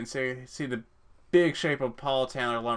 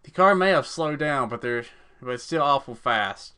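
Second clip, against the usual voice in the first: normal talking voice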